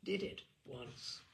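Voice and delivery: low, soft, slow deadpan butler; a dry butler confessing flatly; the butler's voice. Acted. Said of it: "Chives, the butler".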